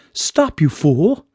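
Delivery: high, slow heroine voice; high-pitched self-reproach; scolding herself. A narrator's reading. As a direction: tell yourself off, pitched well up. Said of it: "Sparkle Girl said to herself".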